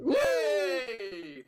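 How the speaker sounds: high happy yay; bright little victory yelp; excited cheer. Real, not acted. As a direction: cheer the win. Celebrating a correct answer.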